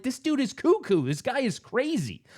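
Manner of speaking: bewildered onlooker. Retold or imitated. Imagined kid's alarmed reaction.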